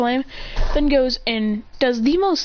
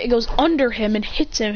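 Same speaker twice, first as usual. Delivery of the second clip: normal talking voice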